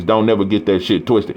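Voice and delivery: ordinary talk, nothing marked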